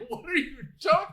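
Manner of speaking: high, loud mock disbelief; hammed-up incredulous cry; mock bewilderment. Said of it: "And she's like".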